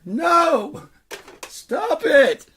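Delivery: high, loud tantrum refusal; screaming little kid. Acted. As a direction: shriek it like a protesting child. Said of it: "he just kept screaming like a little kid".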